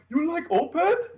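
a fan who just found another fan; thrilled surprise. Retold or imitated. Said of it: "and he's like".

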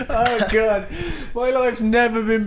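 laughing. Real, not acted. Laughing at own sad joke.

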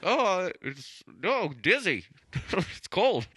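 high squeaky hurt animal voice; high cartoon dog voice. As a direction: cry up from the well, high and silly. Voicing the dog in the well.

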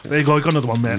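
usual speaking voice

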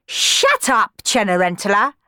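sharp loud yell at her stepsister. Acted. Clorinda snapping at Cenerentola, loud yell. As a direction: bark it at the little sister. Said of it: "yelled Clorinda".

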